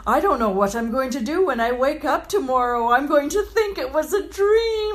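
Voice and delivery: high giddy voice, near tears; overjoyed. Retold or imitated. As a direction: gush like a dream came true. Giddy driver quoted.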